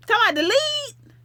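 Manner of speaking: high, fast mocking voice; a quick put-on squeak. Reading a viewer's comment in a mock voice.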